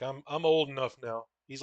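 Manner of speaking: ordinary delivery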